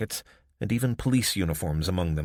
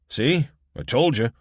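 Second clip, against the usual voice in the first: smug Mitchell voice; 6 semitones higher, 6 dB louder than usual. A narrator's reading. Mitchell gloating.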